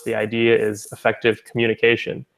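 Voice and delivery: usual speaking voice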